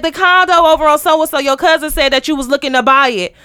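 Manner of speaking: loud mock phone caller; too loud caller. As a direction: talk too loudly down the phone. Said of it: "can you bring it down?".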